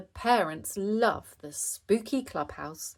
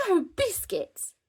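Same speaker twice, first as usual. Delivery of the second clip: high-pitched horrified cry; aghast, pitched way up; mock fright; 12 semitones higher, 4 dB louder than usual. A narrator's reading. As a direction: exclaim in playful puppet horror. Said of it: "making Owlie jump in fright".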